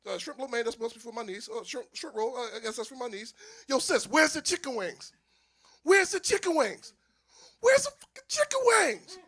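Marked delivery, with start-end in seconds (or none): 3.7-9.2 raised voice climbing to a high yell; building indignant shout. Acted. Brother demanding chicken wings from his sister, escalating to yelling. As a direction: demand it louder and higher each time.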